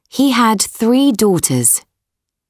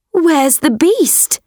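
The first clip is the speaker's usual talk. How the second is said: high, puzzled question; surprised girl voice; 9 semitones higher than usual. A narrator's reading. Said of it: "asked Beauty".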